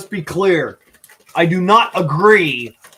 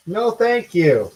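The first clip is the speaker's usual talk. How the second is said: sarcastic thank you; slower than usual. Real, not acted. Refusing a bug in the food.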